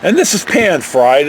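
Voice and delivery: ordinary delivery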